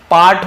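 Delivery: "पार्ट" with a long ah vowel; teacher testing with ah in "पार्ट"; quiz option. Shown as a demonstration. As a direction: offer "पार्ट" with a wide ah. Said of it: "क्या होगा बताइए".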